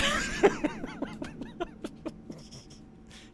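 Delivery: squealing scared laugh; high shrieking laugh. Real, not acted. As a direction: laugh out the fright. After jump scare.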